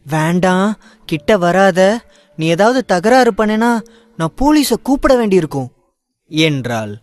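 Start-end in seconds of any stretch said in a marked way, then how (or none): 0.1-5.7 frightened warning. Acted. Ayesha warns off the attacker.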